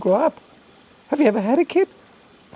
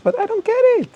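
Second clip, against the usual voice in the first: high-pitched exasperated rant; incredulous outburst, pitched far above usual; worked-up, emotional. Real, not acted. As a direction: let real bafflement push the voice way up. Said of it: "I'm always getting a little emotional".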